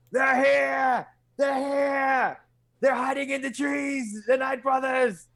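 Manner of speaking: high, straining shout with long drawn words; shouted warning. Acted. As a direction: cry out an alarm as if running toward help. Character runs shouting a warning.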